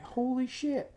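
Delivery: high, hushed mock astonishment; high-pitched awed exclamation; retold amazement. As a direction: play up being blown away.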